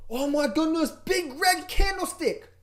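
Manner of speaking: high, slow mock horror; mock panic. Mocking a trader staring at a red candle, high slow horror.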